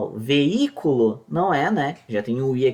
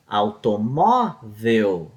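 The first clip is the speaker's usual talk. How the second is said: "automóvel" said slowly with the "mó" syllable leaned on; stress on MÓ; 5 semitones lower, much slower than usual. Shown as a demonstration. Teacher sounding out the stressed syllable for students, slowly. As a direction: draw out "automóvel", landing on "mó". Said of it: "tem o acento no O aqui".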